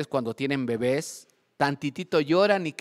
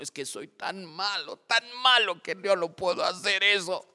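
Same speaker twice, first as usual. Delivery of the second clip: higher, hammed-up moaning of unworthiness; mock self-pitying lament; 5 semitones higher than usual. Church brother refusing to read the Psalm, mock self-pity. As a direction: lament it like an overdramatic penitent.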